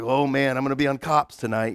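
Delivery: a light imitated homeowner's dread; mock worried aside. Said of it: "you're like".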